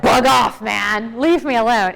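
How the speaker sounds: a half-acted snap to be left alone; mock brush-off. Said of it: "Someone's gonna be like".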